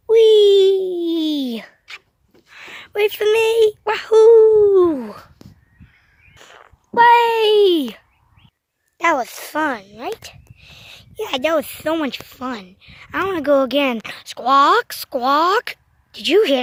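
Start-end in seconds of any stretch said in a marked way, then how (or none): 0.1-8.0 higher, louder joyful shouts; loud, higher slide whoops; excited whoops. Pretend. Toys going down the slide. 14.2-15.8 louder squawking parrot call; rising parrot squawk. Pretend. That sounded like a parrot.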